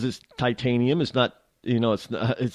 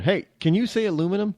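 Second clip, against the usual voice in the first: put-on quick, higher coaxing question to a child; adult asking a kid; 7 semitones higher, faster than usual. Host acting out asking a four-year-old, quick and higher-pitched. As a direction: ask it like coaxing a small kid. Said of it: "ask a four or five-year-old".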